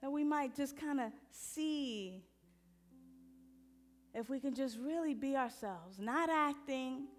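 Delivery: soft, unhurried meditation guidance; gentle meditation guide; guided meditation. Acted. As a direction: ease the room into silence. Leads a meditation.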